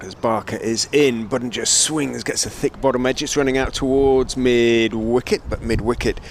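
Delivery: lifted, animated ball-by-ball call; live cricket play-by-play. Real, not acted. Commentator following a ball towards mid-wicket, animated live call. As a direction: call the action as it happens.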